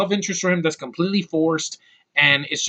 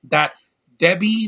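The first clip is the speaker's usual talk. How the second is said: ordinary talk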